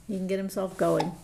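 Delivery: ordinary delivery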